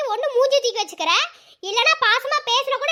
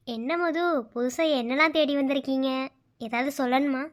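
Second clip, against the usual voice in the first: soft, gentle; 8 semitones lower, 6 dB quieter than usual. Acted. Gentle low talk to Madhu.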